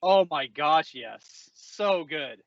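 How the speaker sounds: ordinary delivery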